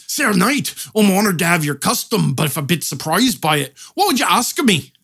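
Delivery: Scottish blacksmith addressing a knight; put-on Scots brogue, honored but a bit surprised; Scottish accent. Blacksmith honored by a knight's custom, Scottish accent. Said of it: "Suppose then the blacksmith says".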